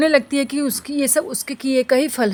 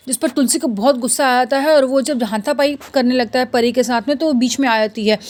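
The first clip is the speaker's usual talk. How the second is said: ordinary talk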